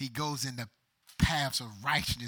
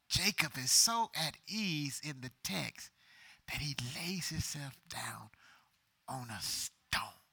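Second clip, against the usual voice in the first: usual speaking voice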